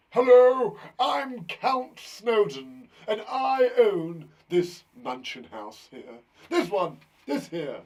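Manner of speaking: loud, slow, drawn-out aristocratic count act; hammed-up count, loud and stretched out; Count Snowden. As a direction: announce yourself as a grand, showy count. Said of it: "Not Count Snowden, He's a friend of mine".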